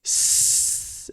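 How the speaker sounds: S as a long sustained hiss; long S sound. Shown as a demonstration. Teacher showing the start of C, long hissed S. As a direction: hold the opening S of the letter C. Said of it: "kailangan medyo mahaba eh".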